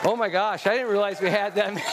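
surprised, quick, breaking into laughter; amused surprise. Real, not acted. Preacher seeing how many young people lined up, amused surprise. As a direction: blurt it out, then rush into a laugh.

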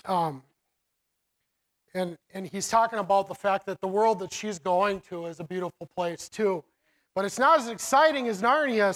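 ordinary talk

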